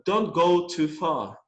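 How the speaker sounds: slow, careful English command; slow model reading. Shown as a demonstration. Teacher reading a command slowly. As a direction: read it out slowly. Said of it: "posso falar essa última frase".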